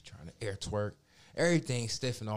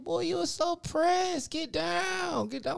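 drawn-out, very high-pitched mock yell; imitated yelling; 4 dB louder, slower than usual. Imitating drunk woman yelling at the dog, high mock yell. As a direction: yell it way up high, stretching the words. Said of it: "And she's yelling".

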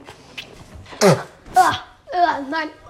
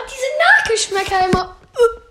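whiny half-crying; 5 semitones higher, 5 dB louder than usual. Real, not acted. Lingering taste of chili.

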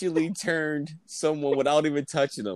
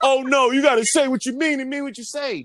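much higher, prissy mock of her; mock literal friend; 9 semitones higher, 4 dB louder than usual. Host mimicking co-host's literal rule, high mock voice. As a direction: imitate her being preachy. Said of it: "And I'd be like".

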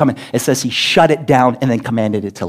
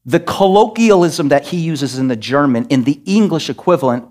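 ordinary talk

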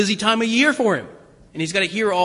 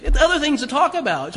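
amused scolding; 6 semitones higher, 3 dB louder than usual. Retold or imitated.

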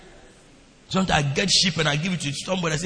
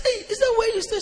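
high, amazed "Hey, is that where you stay?"; shocked disbelief. Retold or imitated. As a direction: gasp at the sight of the place, pitched way up. Shocked at the wild place.

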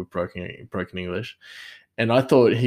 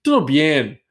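"tudo bem" said in a Brazilian accent, higher and louder; kitchen Portuguese; 6 semitones higher, 7 dB louder than usual. Shown as a demonstration. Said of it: "or you say".

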